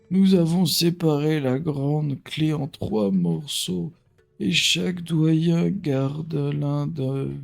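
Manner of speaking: slow, lightly voiced village elder; old man voice. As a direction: read the elder's plea gently. Reads elder NPC dialogue.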